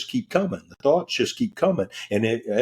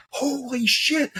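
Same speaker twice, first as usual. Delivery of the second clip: high startled exclamation; shocked; slower than usual. Retold or imitated. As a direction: gasp it out in shock. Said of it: "and I said".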